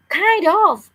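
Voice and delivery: high, loud call up from below; girl shouting up to the boys from the cave; yelled. A narrator's reading. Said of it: "Sally yelled".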